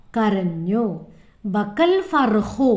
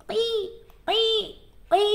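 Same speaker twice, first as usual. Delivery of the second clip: chick cheeping; 11 semitones higher than usual. Shown as a demonstration. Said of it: "പിന്നെയോ എങ്ങനെയാണ് കരഞ്ഞത്?".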